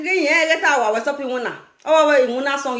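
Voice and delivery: ordinary talk, nothing marked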